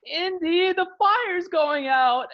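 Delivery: high, loud, slow panicked shriek; high panicky warning about the fire; mock panicked cry. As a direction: squeal it like a scared heroine in a movie. Retelling Marion's panicked cry.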